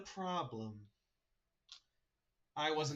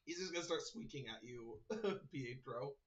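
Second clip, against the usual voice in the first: normal talking voice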